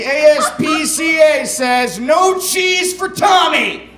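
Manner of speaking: high, rising, drawn-out announcement; mock-solemn proclamation. Author reads final page warning to audience, mock-solemn proclamation. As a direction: proclaim it as the book's grand moral.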